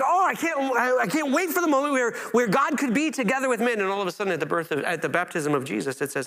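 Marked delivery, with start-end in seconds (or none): 0.0-3.8 higher, eager voice aching for the moment; lightly voiced impatient longing; longing prophets. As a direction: prophets who can't wait for God. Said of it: "And they're like".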